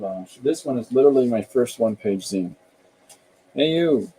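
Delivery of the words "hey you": raised-pitch call to get attention; mock yell. Reading the zine aloud, calling out. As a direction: call out as if hailing someone. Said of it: "Did you hear me yell".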